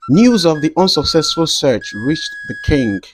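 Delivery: usual speaking voice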